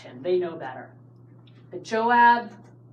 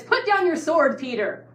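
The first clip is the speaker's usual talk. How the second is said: mock David voice; 7 semitones higher, 6 dB louder than usual. Joking misquote of David.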